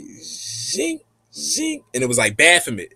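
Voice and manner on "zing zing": high, soft rising zing; imitated zing. Showing the noise the face made, high zing. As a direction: make a high ringing zing, sweeping up. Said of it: "And then it said".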